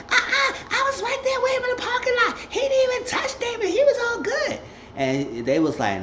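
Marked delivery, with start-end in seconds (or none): 0.1-4.6 very high, squeaky mother's voice defending her son; high falsetto mother. Retold or imitated. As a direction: play the mom in a high falsetto. Mama character voiced in recap.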